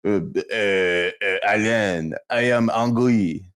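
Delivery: halting, imitated French accent; slow mock French, hesitating before the words; thick French accent. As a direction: grope for English in a French accent. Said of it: "he was thick with it".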